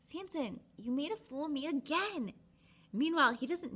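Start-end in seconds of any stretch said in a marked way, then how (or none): 0.0-2.3 higher exasperated whine; whiny Delilah. Retold or imitated. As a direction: complain like a sulky Delilah. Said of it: "So she's like".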